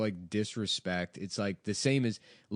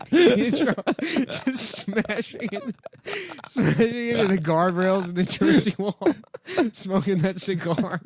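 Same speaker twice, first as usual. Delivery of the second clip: much higher, laughing through the words; laughing while telling; 10 semitones higher, 6 dB louder than usual. Real, not acted. Comedian laughing at his own Tony Soprano bit. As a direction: crack up as you describe it.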